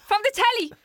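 put-on high, proud little kid; boastful kid. Kid actor boasting to Kieran, high and proud. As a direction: puff up like a proud child star.

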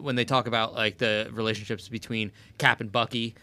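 ordinary talk

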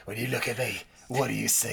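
low, soft, put-on menace; mock tough-guy Tarzan. Podcaster playing Statham's Tarzan, low mock menace. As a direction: drop it low and quiet like an action hero.

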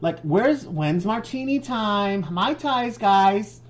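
put-on very high, stretched Valley Girl. Stede asking for cocktails, high Valley Girl voice. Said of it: "I'll be Valley Girl".

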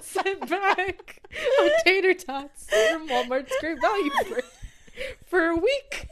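high, loud laughing voice; laughing hard while talking. Real, not acted. Laughing while telling story.

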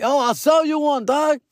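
high-pitched call to someone far off; calling out an offer. Retold or imitated. Calling offer to departing men.

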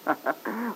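short amused laugh, light and airy; mocking laugh. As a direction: laugh her anger off. Husband laughs off wife's anger.

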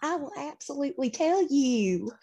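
high, eager, overly agreeable promise; mock-sincere assurance. Player as Cerulean assuring the captive bird, high and eager. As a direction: lay it on thick, playing along.

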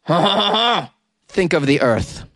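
put-on comic French accent with a breathy ha-ha; cartoon Frenchman's protest; mock French accent. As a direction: yell it lightly in hammy French. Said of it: "That's how I do my French accent".

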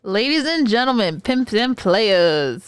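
drawn-out, loud emcee intro; playful hype-host opening; show announcer. Pretend. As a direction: stretch it out like a ringside introduction.

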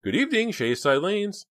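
high, loud sing-out phone greeting; chirpy high receptionist; woman on speakerphone. Acted. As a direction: answer the business line in a high-pitched receptionist voice. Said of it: "a woman's voice sounded over the speakerphone".

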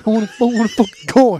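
loud blurted punchline in a mock British accent; mock shout. Blurting his point to his friend, loud mock British shout.

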